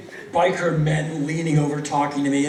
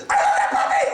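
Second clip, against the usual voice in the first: strangled high squeal of love; gushing dog lover; 3 dB louder, much faster than usual. Retold or imitated. Someone meeting a young dog in the park, squealing. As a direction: squeal over an adorable puppy.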